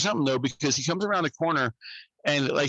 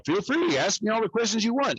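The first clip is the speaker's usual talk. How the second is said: higher, sarcastic welcome; lightly hammed-up invitation; mock sarcastic offer; 9 semitones higher than usual. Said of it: "And I was all".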